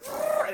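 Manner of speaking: high, loud revving car noise; car-engine imitation. Storyteller imitating van speeding away, high engine trill. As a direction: mouth a car peeling out. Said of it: "that's my car taking off".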